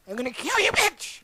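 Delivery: shrieking villain on the last word; witch threat. Retold or imitated. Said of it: "she turns around and goes".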